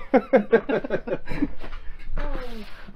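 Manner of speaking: high, soft peal of laughter; long laugh. Real, not acted. Friend teasing careful Rambo, laughing.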